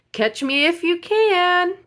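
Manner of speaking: high, slow sing-song taunt; high teasing sing-song from a distance; cocky gingerbread shout. A narrator's reading. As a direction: call it out high like a cheeky cookie. Gingerbread baby shouting from afar.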